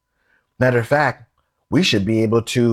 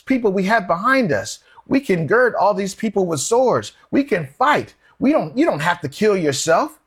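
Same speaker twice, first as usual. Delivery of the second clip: higher-pitched, urgent objection as Peter; urgent protest; 6 semitones higher than usual. Retold or imitated. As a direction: plead against the plan in a raised pitch. Peter stops Jesus and protests.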